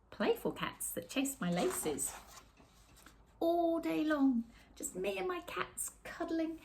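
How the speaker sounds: usual speaking voice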